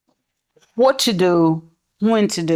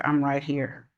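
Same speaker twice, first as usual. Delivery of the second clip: calm quiet; 4 semitones lower, 8 dB quieter than usual. Retold or imitated. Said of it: "I would say".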